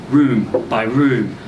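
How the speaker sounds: ordinary delivery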